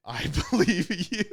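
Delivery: high, loud quote through laughter; laughing imitation of an opponent; laughing retold reply. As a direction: retell it while cracking up. Laughing while retelling opponent's reply.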